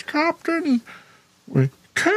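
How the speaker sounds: shrill cartoon call, high then dropping; Captain Caveman. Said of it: "Captain Caveman cartoon when I was a kid".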